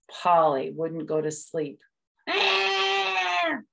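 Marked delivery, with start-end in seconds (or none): 2.3-3.6 put-on high long baby cry; baby crying at night; baby wail. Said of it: "she cried and cried".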